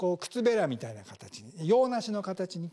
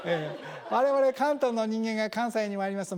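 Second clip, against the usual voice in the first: usual speaking voice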